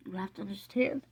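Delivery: choked up, soft voice breaking upward; softly, on the edge of crying; emotional. Real, not acted. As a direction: fight back real tears while speaking. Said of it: "this is so emotional".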